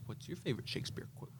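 breathless, unvoiced quick line; whispered woman's voice. Retelling a Succession scene, the woman's line whispered. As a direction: hush it out as the Shakespeare-quoting woman. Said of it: "And then she says".

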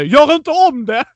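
high, loud drawn-out scolding yell; retold scream. Driver screaming at the moped girl, mock-furious yell. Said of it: "skriker till henne".

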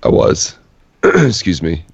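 hoarse, barely any voice; whispering with a lost voice; raspy whisper. Real, not acted. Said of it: "I have no voice".

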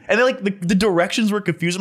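usual speaking voice

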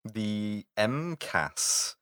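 "M cas" said slowly as one word, em-kass; M cas pronunciation. Shown as a demonstration. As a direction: say "M cas" drawn out, em then kass. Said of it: "Do they call it an M C A S or an M cas".

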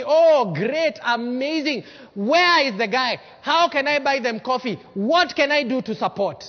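loud, eager show of delight; big, demonstrative congratulation; acted enthusiasm. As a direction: cheer on someone else's success. Models rejoicing at a rival's success.

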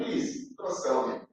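soft broken plea. Acted. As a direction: let the plea sink away softly. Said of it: "Joseph was pleading, begging".

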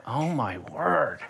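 slow, hushed "Oh my word"; awed gasp. Retold or imitated. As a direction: breathe it out in quiet awe. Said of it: "And went".

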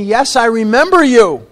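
loud, high, emphatic exclamation; pointed recognition. Retold or imitated. Dentist's daughter recognizing old patient, loud exclamation. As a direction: imitate her crying out in recognition.

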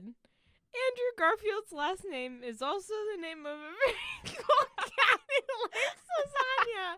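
words breaking into giggles, pitch climbing on the last part; reading the statement but laughter takes over; laughing while reading. Real, not acted.

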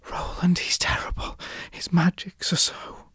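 tearful whisper. Acted. Sister warns Roland of the king, tearful whisper. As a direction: breathe it out through tears. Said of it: "her eyes filled with tears".

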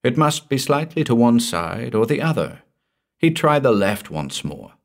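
plain reading voice

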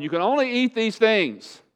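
high-pitched mock decree; mock rule-giving. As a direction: lay down the rule as a false teacher, high. Mimics false teachers' food rules.

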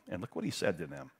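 ordinary delivery

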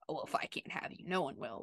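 lightly hammed-up creepy whisper, dropping the voice; mock creepy whisper. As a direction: whisper it like a mock obsessive lover, low and breathy. Mocking an obsessive lover's line.

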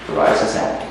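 breathy whisper of the phrase; whispered mock king's echo. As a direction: breathe it out as a throwaway aside. Repeated line, breathy aside.

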